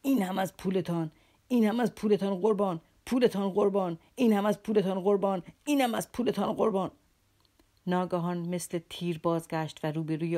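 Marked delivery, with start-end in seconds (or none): 0.0-6.9 breathless, louder furious repeats; panting rage. A narrator's reading. Captain stamps on the money, panting and furious. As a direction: gasp it out while stamping.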